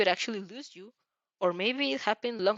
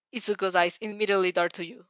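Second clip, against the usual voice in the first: usual speaking voice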